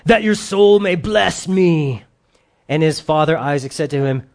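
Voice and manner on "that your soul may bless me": put-on slow, drawn-out Esau accent, a bit higher; a hint of a hunter's accent, drawn out; Esau's accent. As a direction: give Esau a rough country accent, slowly. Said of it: "That's his accent".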